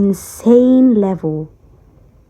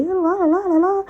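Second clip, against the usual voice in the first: mocking la la la; 10 semitones higher than usual. Mocking copycat crowd sing-song.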